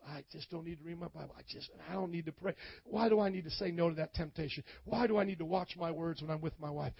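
a backslider's muttered complaints, quick and soft; lazy excuses. Acted. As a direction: rattle them off under your breath, dismissive.

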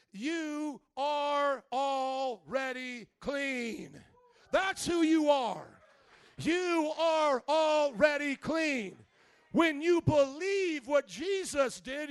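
very slow, loud, ringing proclamation; full-force preacher declaration; slow preaching shout. Shown as a demonstration. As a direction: drive each word home to the crowd. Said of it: "preaching at full force".

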